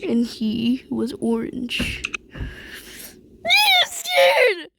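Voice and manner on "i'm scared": high frightened wail; scared cry. Real, not acted. Kid frightened playing horror game.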